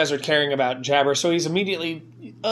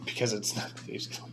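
whisper; 5 semitones lower, 12 dB quieter than usual. Real, not acted. GM secret hint to player.

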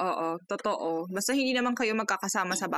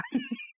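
quiet high giggle; a small, soft, high chuckle at herself; soft laugh; 8 dB quieter than usual. Real, not acted. Friend laughs at her own rheumatism joke, soft giggle. Joking about rheumatism check.

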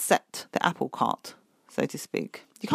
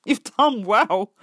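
proud praise; 5 semitones higher, 6 dB louder, slower than usual. Retold or imitated. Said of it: "you're like".

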